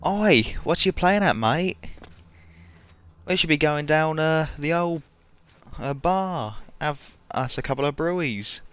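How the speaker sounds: put-on Australian accent. Streamer playing an Aussie heading to the bar, mock Australian accent. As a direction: do an amateur, matey Australian accent. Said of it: "not the best Australian accent".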